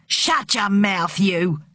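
slow, shouted command to be silent; angry order. A narrator's reading. Bullying husband silencing his timid wife, angry order. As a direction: shout her down, drawing out the words.

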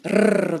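a rolled rrr with the tongue tip; trilled R. Shown as a demonstration. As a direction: trill the tongue on the gum ridge. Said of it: "tapping the tip of your tongue in a trill".

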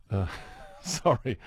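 laughing. Real, not acted. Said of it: "I will get my breath back".

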